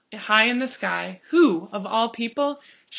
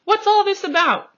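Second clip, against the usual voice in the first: much higher, loud astonished call; shouting crowd; 11 semitones higher, 8 dB louder than usual. A narrator's reading. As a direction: cry it out in amazement. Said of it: "the people came shouting".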